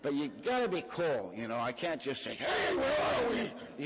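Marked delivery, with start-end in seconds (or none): 2.3-3.8 very high, slow, drawn-out panic; a yelp of panic; stretched high alarmed cry; panicked question. Pretend. Panicky question he avoids asking.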